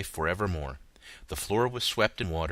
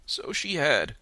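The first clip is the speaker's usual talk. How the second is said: put-on high, eager old Scrooge; old man crying out; 9 semitones higher, slower than usual. A narrator's reading. Scrooge crying out to the ghost, high and eager. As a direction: cry it out, moved and high. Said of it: "cried Scrooge".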